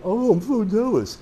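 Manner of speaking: high, slow mocking whine; mock whiny jealousy. Instructor mocking students who envy the camp, high, slow put-on whine. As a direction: parrot the envious students in a silly put-on voice. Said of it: "And I don't wanna hear".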